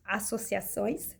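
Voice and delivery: "associações" said slowly, syllable by syllable, stumbling; struggling with a word. Real, not acted. Telling viewers her study story, slowly stumbling over "associações". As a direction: carefully sound out "associações" after false starts. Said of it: "Difícil pra mim falar associações".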